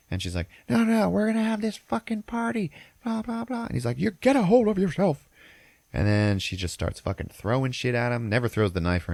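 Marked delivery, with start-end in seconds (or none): 0.7-3.7 very high mock-hysterical woman's voice; drunk wife ranting. Drunk wife waving knife at guests, high mock rant. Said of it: "'cause she's drunk". 4.0-5.3 very high, louder mock-exasperated husband; retold scolding. Husband to raving drunk wife, high mock scolding.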